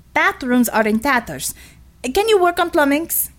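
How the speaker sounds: put-on brisk Russian-accented commander, rising on the question; lightly Russian heroine barking orders mid-fight.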